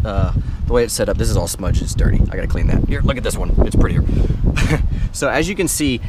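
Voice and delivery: loud voice over the wind noise; shouting over wind. Real, not acted. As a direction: raise your voice over the gusts. Filming outside in the wind.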